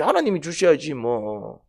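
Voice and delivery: normal talking voice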